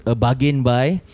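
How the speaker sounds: ordinary talk, nothing marked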